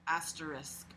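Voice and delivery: ordinary delivery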